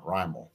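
low, soft RYE-mul for Raimel; Matt Vasgersian. Retold or imitated. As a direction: say Raimel low with a swallowed ending. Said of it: "it's Matt Vasgersian doing it".

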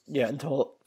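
throat catching mid-word; choking up. Real, not acted. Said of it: "I'm choking up because I".

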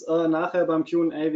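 ordinary delivery

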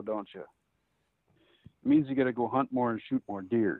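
usual speaking voice

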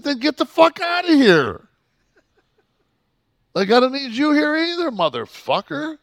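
full-volume, high-pitched furious yell; loud enraged dismissal, voice shooting way up; retold scream. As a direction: re-enact screaming someone out of the place. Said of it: "hear me scream at this motherfucker".

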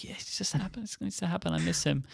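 soft and high, pining for his co-host; a hammed-up lonely sigh of a quote; mock forlorn. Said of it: "I'm like".

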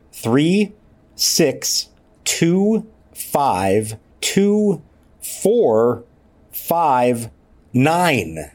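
each number set apart, pitch jumping; a playful roll call of throws; ticking off the numbers. Real, not acted. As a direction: count off each throw with a pause. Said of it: "All right, with the numbers".

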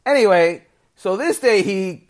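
ordinary talk

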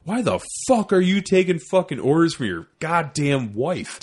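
heated, emphatic dressing-down, reenacted; indignant sergeant laying into him; retold angry outburst. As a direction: retell chewing out a soldier, punching the swear words.

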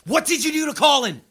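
furious demand; angry shout. Acted. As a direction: shout it, high and loud. Confronting captors, escalating.

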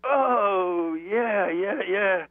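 high, drawn-out realization; excited recognition. Retold or imitated. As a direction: re-enact a delighted moment of recognition. Acts out recognizing Manchester bands.

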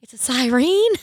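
retold baffled question; incredulous echo. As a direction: repeat it back in rising mock disbelief. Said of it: "And I'm like".

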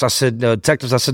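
ordinary delivery, nothing marked

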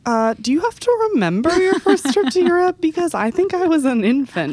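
mock-worried high-pitched question; imitating colleague. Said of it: "she was like".

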